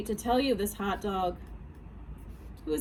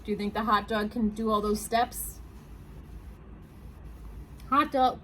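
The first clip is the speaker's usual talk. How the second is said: ordinary delivery, nothing marked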